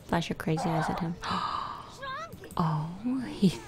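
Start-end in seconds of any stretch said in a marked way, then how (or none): 1.2-2.5 high, sudden breathy gasp of shock; a startled intake of breath; sharp gasp. Real, not acted. Watching the sim flash crazy eyes, a sharp high gasp.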